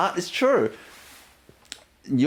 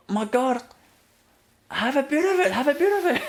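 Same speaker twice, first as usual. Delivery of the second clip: eager offering; 10 semitones higher than usual. Retold or imitated. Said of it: "I just feel like".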